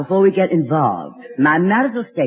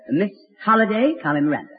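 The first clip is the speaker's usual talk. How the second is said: usual speaking voice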